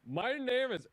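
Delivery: hammed-up applicant voice; mock applicant. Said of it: "he comes in and he's like".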